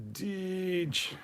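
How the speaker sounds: high drawn-out Deej cry; Kirk-style yell. Pretend. Streamer jokes Kirk would yell Deej instead, drawn-out cry. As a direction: stretch Deej out like Kirk's Khan. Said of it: "he would yell".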